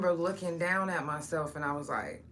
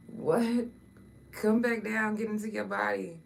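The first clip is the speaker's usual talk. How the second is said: incredulous; 6 semitones higher, 4 dB louder than usual. Retold or imitated. Retold near-death panic to self.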